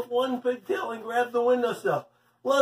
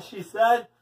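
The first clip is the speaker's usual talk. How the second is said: usual speaking voice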